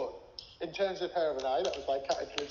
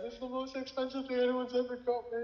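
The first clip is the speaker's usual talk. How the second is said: retold tearful thanks; 11 semitones higher than usual. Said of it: "I just burst out in tears".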